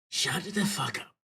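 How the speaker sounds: slow, put-on character voice; his voice. Redoing the character's threat in his proper voice. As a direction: growl it out as him, slowly. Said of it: "that's not his voice".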